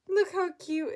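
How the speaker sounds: high, loud gushing over the cat; delighted owner showing cat to viewers; cooing at cat. Real, not acted. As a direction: squeal how cute it is.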